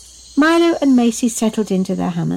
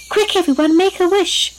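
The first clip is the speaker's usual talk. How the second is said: high, urgent call to everyone; excited high-pitched call; 10 semitones higher than usual. A narrator's reading. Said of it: "called out Flo".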